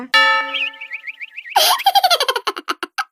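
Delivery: high, sly cackle; a mischievous snicker; gloating laugh. Acted. Gloating over the trap she set for the friend.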